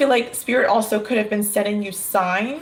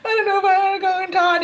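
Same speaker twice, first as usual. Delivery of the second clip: much higher, fast sing-song whimper; whiny scared kid; 9 semitones higher, faster than usual. Pretend. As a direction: whine with a finger in the mouth like a toddler. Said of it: "his finger in his mouth and going".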